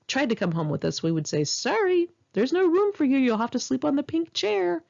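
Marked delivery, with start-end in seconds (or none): 1.4-4.8 mock apology, pitched much higher; joking. Friends teasingly turning guys away at the door, mock apologetic. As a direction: tease them with a sing-song fake sorry. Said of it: "as a joke".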